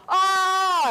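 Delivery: a sudden high loud wail of grief; wailing cry. Retold or imitated. Grieving sailors heard wailing at dawn, loud wail. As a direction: cry out "Oh" in anguish. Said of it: "he hears this wailing".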